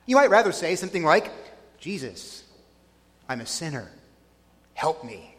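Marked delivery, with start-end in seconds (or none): 1.8-5.3 hushed, low plea; humble prayer. Pretend. Sinner asking Jesus for help, hushed and humble. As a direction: pray it very softly, with pauses.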